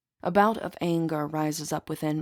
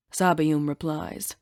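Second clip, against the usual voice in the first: ordinary delivery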